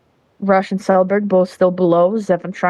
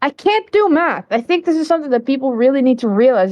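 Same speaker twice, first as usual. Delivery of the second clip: self-mocking; 6 semitones higher than usual. Real, not acted. Laughing at own mistake.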